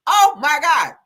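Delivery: loud high oh my God in real shock; shocked gasp. Real, not acted. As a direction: gasp it out, truly stunned. Shocked reaction to picture.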